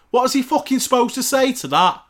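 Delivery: loud, high, outraged question; real exasperated yelling; exasperated outburst. Real, not acted. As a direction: blast it out in disbelief. Exasperated rant about press question.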